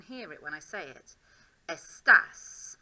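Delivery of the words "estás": Estás with TÁS hit hard and loud; stress on TÁS. Shown as a demonstration. Teacher drilling students, stressing the accented syllable of Estás. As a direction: punch the second syllable of Estás. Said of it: "I'm stressing that sound".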